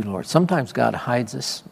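usual speaking voice

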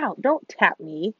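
ordinary delivery

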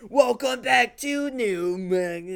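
high, loud, slow put-on host voice; switched-body voice. Mocking the host's mid-speech voice switch, exaggerated welcome. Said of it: "he, like, switched between bodies".

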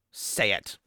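low, emphatic mock order; forceful command. She orders him to use her name, forceful. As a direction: press each word firmly, playfully overdone. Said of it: "so, so forceful".

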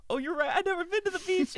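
very high, loud comic panic; mock freak-out. Friend realizing his story fails, mock freak-out. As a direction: squeal the confession in hammed-up panic. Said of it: "he's, like, freaking out".

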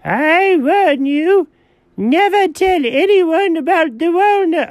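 high, drawn-out warning; quoting great aunt, high and slow; great aunt's warning. Acted. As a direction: warn them slowly in a high, urgent voice. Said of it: "She said".

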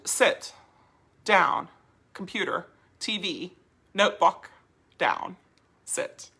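SIT, DOWN, COMPUTER, T V, NOTEBOOK as even single words; evenly spaced words with no question lift on DOWN or SIT; all the same. Shown as a demonstration. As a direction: say SIT and DOWN just like naming objects. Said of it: "All the same".

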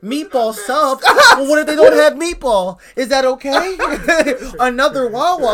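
high, loud, eager housewife; seventies housewife. Retold or imitated. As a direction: fuss over him like a housewife. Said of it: "you sound like a seventies housewife".

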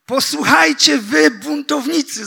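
high, loud, rebuking cry to the rebels; shouted. Acted. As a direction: call them out at full voice. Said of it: "zawołał".

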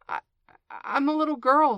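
halting start, then high and loud; falter, then offer it uncertainly; rather doubtful. A narrator's reading. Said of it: "rather doubtfully".

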